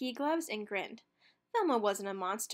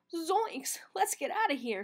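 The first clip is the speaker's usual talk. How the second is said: scared cry; 6 semitones higher than usual. A narrator's reading. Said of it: "Shaggy cried".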